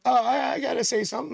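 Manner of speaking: hammed-up keen meeting voice; mock eager coworker. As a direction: mimic the one who always has to add something. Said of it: "And then".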